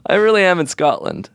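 high, hammed-up fake sobbing; mock crying. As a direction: fake crying about being in Scotland. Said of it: "You're crying".

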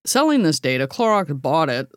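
ordinary delivery

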